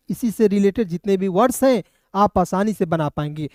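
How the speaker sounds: normal talking voice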